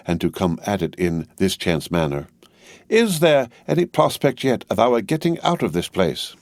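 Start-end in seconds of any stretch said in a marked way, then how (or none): 2.9-6.4 brisk high-voiced Englishwoman; a bustling, bossy housekeeper; Miss Pross voice. A narrator's reading. Miss Pross casual question.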